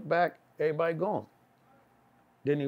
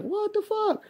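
stunned disbelief; 4 dB louder, slower than usual. Retold or imitated. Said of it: "I'm like".

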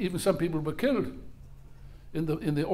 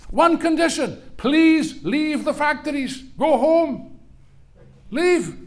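raised, high and loud plea to leave; insistent demand; 8 semitones higher, 6 dB louder, slower than usual. Retold or imitated. Bosses urge striking workers to leave the factories, loud and insistent. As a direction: press the one condition hard.

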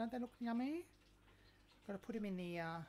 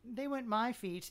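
flustered denial; 4 semitones higher, 9 dB louder than usual. Real, not acted.

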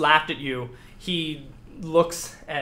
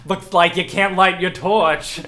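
funny villain voice; 3 semitones higher, 8 dB louder than usual. Acted. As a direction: put on a silly voice to mock the hero. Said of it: "what was that voice?".

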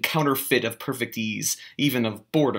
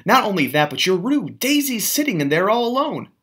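scolding; 8 semitones higher than usual. A narrator's reading. Narrator scolding Gatsby.

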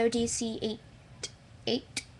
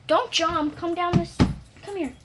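dog command; 6 semitones higher, 4 dB louder than usual. Real, not acted.

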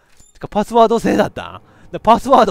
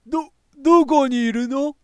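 much higher, searching call; voiced character line; 10 semitones higher, 5 dB louder than usual. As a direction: call out as the worried boy. Reading character line aloud.

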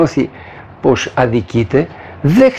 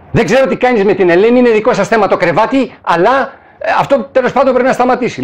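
echoing the angry tone; 6 semitones higher than usual. Retold or imitated. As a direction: repeat his words in his higher raised tone. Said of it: "με τον ίδιο ακριβώς τρόπο που το είπε". (επανάληψη με το ίδιο θυμωμένο ύφος)

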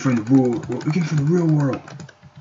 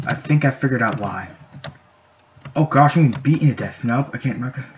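ordinary talk

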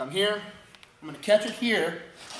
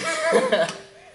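high burst of laughter; 5 dB louder than usual. Real, not acted. Laughs at joke.

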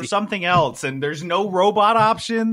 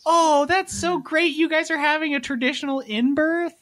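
mock gushing; 11 semitones higher than usual. Congratulating parents on a traditional in-birth, mock gushing. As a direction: coo it like a delighted well-wisher.

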